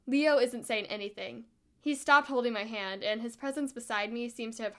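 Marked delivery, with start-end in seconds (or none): none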